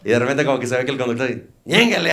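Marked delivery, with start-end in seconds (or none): 1.6-2.1 mock braking cry. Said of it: "así, frenón".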